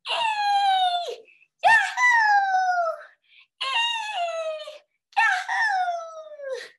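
very high parrot-like whoops; screaming cheer. A narrator's reading. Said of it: "you should scream".

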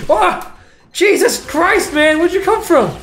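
high, loud shriek of fright; screaming Where'd you come from; startled yell. Real, not acted. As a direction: yelp in real shock at the ambush. Jump scare by enemy.

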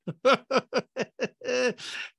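high-pitched string of giggles; laughing. Real, not acted. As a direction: break into a long, high chuckle. Laughs at own joke.

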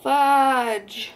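sharp pained outburst; loud exclamation. Real, not acted. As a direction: blurt it out in sudden pain. Just pricked finger with needle.

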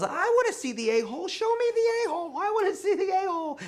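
put-on very high, fast, laughing child voice; a giddy kid begging to see; squeaky, fast child voice with laughter; excited kid. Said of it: "And he goes".

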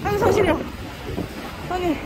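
ordinary delivery

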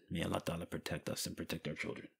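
quick hushed whisper of a prayer. Real, not acted. Teacher whispering a prayer for children's safety. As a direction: murmur a protective prayer under the breath. Prayer aside after grim story.

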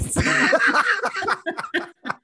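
high, loud burst of laughter. Real, not acted. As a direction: let out a big happy laugh. Big laugh with host.

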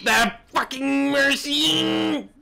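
drawn-out high gremlin screech. Pretend. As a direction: stretch it out like a gremlin. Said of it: "I went gremlin".

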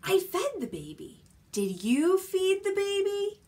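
singsong storybook voice for little ones; reading to a toddler. Acted. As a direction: read it playfully, question and answer. Reading picture book to toddlers.

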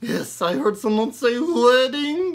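high, loud pig puppet voice; squeaky ventriloquist dummy. Acted. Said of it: "Oh, hey, Howard".

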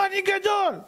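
put-on high-pitched little boy's boast; insistent child. Boy arguing with his father, insistent.

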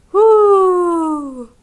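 high, loud rising-and-falling ghostly whoo; spooky cave wail. Acted. Big brother booming a spooky cave cry at his sister. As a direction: wail like a haunted cave crying out. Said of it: "read Brother with a loud expression".

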